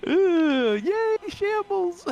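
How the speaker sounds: high, slow fake cheer; mock cheer. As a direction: cheer sarcastically, drawn out. Mocking the shambles quote.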